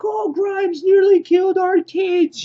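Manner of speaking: high, loud, hammed-up wail of frantic parents; panicked parents. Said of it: "freaking out, tears pouring out".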